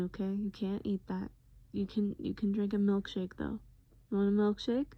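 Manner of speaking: soft, half-whispered comforting; caring boyfriend, coaxing. Acted. Boyfriend offering droopy-eyed partner a milkshake, tenderly coaxing. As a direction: coax someone groggy, gently and patiently.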